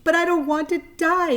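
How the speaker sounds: high, loud desperate wail; a pig throwing himself down screaming. A narrator's reading. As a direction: scream it in despair. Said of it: "screamed Wilbur".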